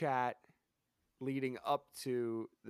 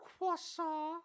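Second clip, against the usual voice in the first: much higher cartoon kid voice; Carl Wheezer voice; 14 semitones higher than usual. Cohost echoing Carl's catchphrase, high cartoon voice. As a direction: chirp it like a goofy cartoon boy. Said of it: "what does Carl say all the time?".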